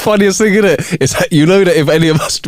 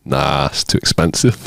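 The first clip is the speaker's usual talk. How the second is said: low dismissive man; 13 semitones lower, 4 dB quieter than usual. Acted. Man replies flatly.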